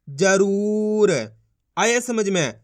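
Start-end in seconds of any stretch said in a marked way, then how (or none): 0.1-1.1 drawn-out syllable blend, very slow; slow blended reading. Shown as a demonstration. Teacher showing children how to blend syllables, drawn out slowly. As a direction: stretch the word out as you join the sounds. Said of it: "देखो किस तरीके से".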